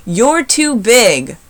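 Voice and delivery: very slow, loud shout. A narrator's reading. Sammy shouts at Kevin, slow and loud. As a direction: drag out the put-down. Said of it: "Sammy shouted".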